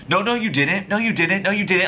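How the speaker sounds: high-pitched, repetitive contradicting; acted insistent denial. Bartender's insistent denial in quarrel.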